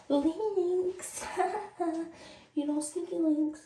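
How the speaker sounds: high sing-out with a laugh; petty teasing. Retold or imitated. Getting back at girlfriend with a photo, gleefully mocking.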